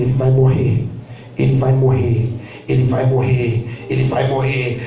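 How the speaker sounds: voz bem mais grave, monótona; médico grave e repetitivo. Retold or imitated. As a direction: repete a sentença como um martelo. Médico repete sentença fria. (médico repetindo com voz grave)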